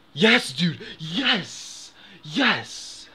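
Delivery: real joy at the win; excited yes. Real, not acted. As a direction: punch the air with each yes. Winning spin.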